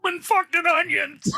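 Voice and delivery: high mock shouting parent; rushing dad. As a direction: hurry the family along. Echoing the parent bit.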